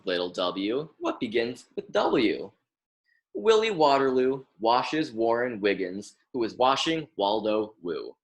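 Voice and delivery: normal talking voice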